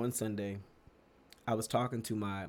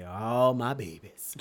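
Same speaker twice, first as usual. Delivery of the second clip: put-on slow, tender call to the kids; fond pastor; 6 dB louder, much slower than usual. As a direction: lovingly draw it out like the pastor. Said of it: "he'd say".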